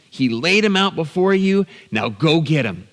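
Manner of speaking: high-pitched, insistent push; urging revenge. Retold or imitated. Son urging dad to seize the moment, insistent goading. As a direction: egg him on to strike.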